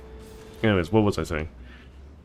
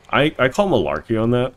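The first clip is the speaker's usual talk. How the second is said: louder, higher indignant complaint; indignant protest; 6 semitones higher, 8 dB louder than usual. Real, not acted. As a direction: protest it like it's unfair. Protests after getting caught.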